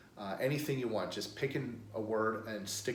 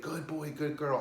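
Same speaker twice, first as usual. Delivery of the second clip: higher, upbeat dog praise; excited praise; 6 semitones higher than usual. Shown as a demonstration. Trainer praising a dog just gone in, excited. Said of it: "act excited".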